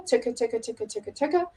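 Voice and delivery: "tuka" rattled off as a quiet repeated tonguing pattern; tonguing syllable demo. Shown as a demonstration. Oboist showing what brass players use, quiet syllable demo. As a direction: chant "tuka" evenly, tongue tip then back of tongue. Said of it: "talk about, um, a, a tuka syllable".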